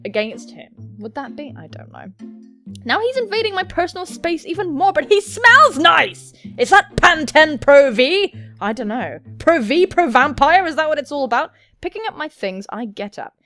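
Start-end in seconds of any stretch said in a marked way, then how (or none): 2.8-8.3 loud, high, punched words; over-the-top excited reaction; excited outburst. Acted. Excited reaction while reading game aloud. 9.4-11.5 excited riffing. Acted. Silly loud riff on shampoo.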